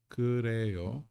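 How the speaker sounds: usual speaking voice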